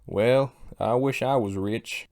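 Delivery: slow southern drawl. Acted. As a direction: put on a drawn-out, hammed-up Southern accent. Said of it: "southern drawl".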